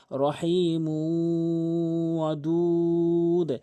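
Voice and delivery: "রহিমু ওয়াদুদ" slow, "মু" held into a nasal w; recited with ghunnah. Shown as a demonstration. Teacher models idgham on mu, slow held "রহিমু ওয়াদুদ". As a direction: stretch "মু" humming into "ওয়াদুদ". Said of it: "এখানে মু ঈদগাম বা গুণনা".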